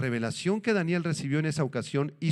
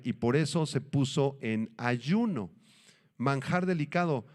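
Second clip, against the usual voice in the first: ordinary talk, nothing marked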